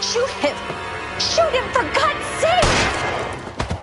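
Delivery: high desperate begging; panicked pleading. Acted. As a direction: beg him to shoot, terrified. Panicked woman begging shooter.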